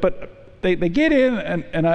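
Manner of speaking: ordinary talk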